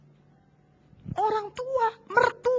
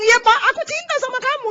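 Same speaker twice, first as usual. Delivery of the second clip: high, lightly voiced wife; a touch of a forced sweet wife; a hint of a wife answering her husband; mock wife; 12 semitones higher, 5 dB louder than usual. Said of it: "Wanita bisa ngomong".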